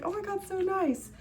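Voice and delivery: high, gushing praise; gushing over two cute drinks; gushing delight. Retold or imitated. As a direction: coo it happily. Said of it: "and I was like".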